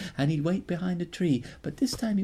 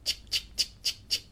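chick spray sound. Acted. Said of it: "he'd go".

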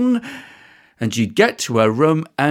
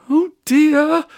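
fretful mum; 10 semitones higher than usual. Acted. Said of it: "thought Mum".

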